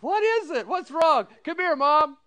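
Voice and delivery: high, loud urgent back and forth; family calling back and forth; excited retold exchange. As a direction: act out the rising confusion.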